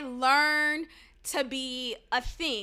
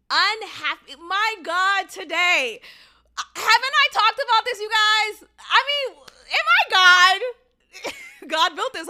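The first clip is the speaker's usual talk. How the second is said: loud, high, self-delighted outburst; hammed-up exclaiming; 7 semitones higher, 8 dB louder than usual. Real, not acted. Podcaster marvelling at her own theory, playfully over-excited. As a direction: build higher and louder, playfully over the top.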